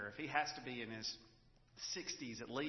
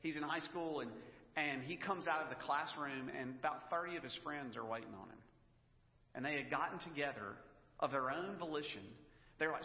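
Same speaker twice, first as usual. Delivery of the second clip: ordinary delivery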